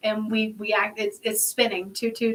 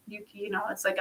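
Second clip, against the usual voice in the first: normal talking voice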